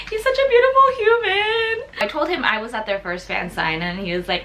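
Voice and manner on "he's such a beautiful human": high, teary fangirl squeal, half-joking; mock crying gush. Recalling the idol's pretty eyes, high teary mock gushing. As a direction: gush like you might cry over him.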